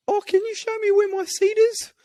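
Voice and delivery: very high-pitched, put-on question. Passenger asking the flight attendant for his seat, in a high put-on voice. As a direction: ask it in a squeaky-high put-on voice.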